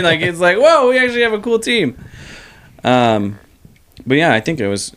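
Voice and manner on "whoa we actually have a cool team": amazed whoa. Retold or imitated. Excited reaction quoted.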